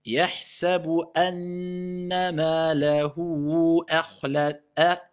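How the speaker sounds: slow, drawn-out chanted recitation. Shown as a demonstration. Teacher recites the verse slowly for the class, chanted. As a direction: intone it slowly, syllable by syllable.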